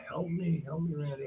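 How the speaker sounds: high, loud frantic cry for help; retold scream. Man pinned by police screams to his friend, high and loud. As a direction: scream it like you're pinned down by cops. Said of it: "he's screaming".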